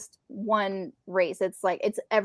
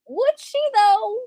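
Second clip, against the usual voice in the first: much higher, sarcastic doubting retort; incredulous; 13 semitones higher, 5 dB louder, slower than usual. Acted. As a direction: throw the question back in open disbelief. Retorting to grandson's quoted claim.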